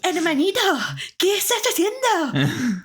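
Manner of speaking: high, lively Castilian Spanish voice; Spanish from Spain accent. Retold or imitated. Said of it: "my Spaniard impression is not the best".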